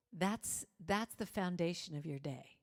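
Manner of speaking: ordinary talk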